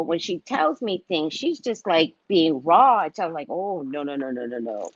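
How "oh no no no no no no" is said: soft, low string of no's; mock soothing. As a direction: drop low and hush them down. Soothing daughter-in-law before asking who did it.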